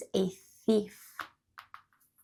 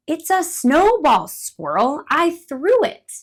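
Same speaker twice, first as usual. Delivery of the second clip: louder, higher teasing bird; playful bird; 7 semitones higher, 8 dB louder than usual. Acted. Playful teasing bird. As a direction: bird tells squirrel he threw snowball, teasing.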